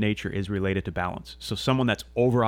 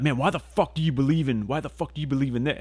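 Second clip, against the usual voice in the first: higher-pitched, mock-confrontational challenge; cussing someone down; 5 semitones higher than usual. Imitating an atheist who comes up cussing, higher mock-hostile voice. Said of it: "cussing me down".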